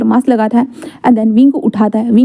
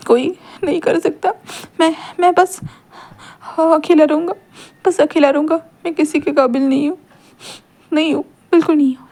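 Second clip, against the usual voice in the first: voice breaking; 5 semitones higher, 4 dB quieter than usual. Acted. Wing breaks down, feeling worthless.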